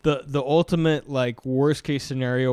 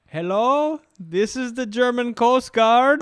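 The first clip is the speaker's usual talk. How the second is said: put-on high, slow German-accented radio answer; hesitant young German coast guard, imitated; 11 semitones higher, 6 dB louder, slower than usual. Young German recruit answering Mayday, halting German accent. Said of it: "but the guy's German".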